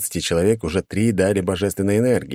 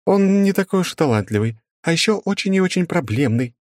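babbling; 12 semitones higher than usual. Acted. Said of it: "залепетала директриса".

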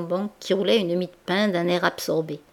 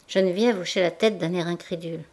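ordinary delivery

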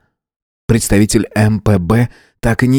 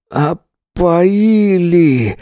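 very slow, strained, forced through a locked jaw; through clenched jaws; 9 semitones higher, much slower than usual. A narrator's reading. Drugged earthling realizes he was poisoned, strained through clenched jaws. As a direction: squeeze it out through cramped teeth. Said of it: "процедил он сквозь сведённые".

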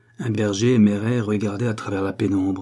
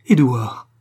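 a louder cry, half sob, half overjoyed; joyful sobbing cry; 3 semitones higher, 6 dB louder than usual. Acted. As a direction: call the lost son's name as a sob of joy. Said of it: "eut un cri qui ressemblait à un sanglot".

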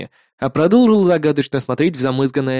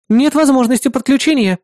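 AI assistant voice; 9 semitones higher than usual. Acted. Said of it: "предупредила Эрис".

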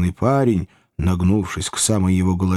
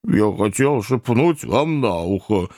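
put-on fat boy; slower than usual. A narrator's reading. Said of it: "отвечал жирный парень".

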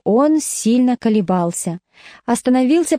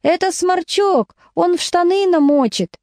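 higher teasing shout; mock choir yell; 7 semitones higher than usual. A narrator's reading. Choir shouting at the teacher about Vaska, higher jeering yell. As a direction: jeer it like the whole choir. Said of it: "Весь хор в разнобой заорал ей".

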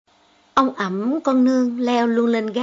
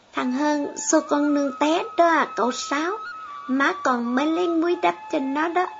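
put-on a bit higher child's voice, eager; lightly voiced child; 5 semitones higher than usual. Girl explaining the fall to her uncle, higher child voice. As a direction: chirp it like a young girl.

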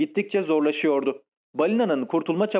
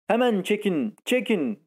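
higher urgent call without added volume; mild narrated shout; 5 semitones higher than usual. A narrator's reading. Captain urging the crew to haul, mild shout. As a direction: repeat the command, pitched up, lightly shouted. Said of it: "diye bağırıyordu".

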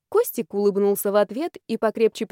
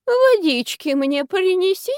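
high, slow feeble plea; faking a dying granny; having a heart attack; 10 semitones higher, 4 dB louder, slower than usual. Acted. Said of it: "простонала аферистка".

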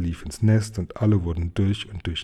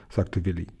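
ordinary delivery, nothing marked

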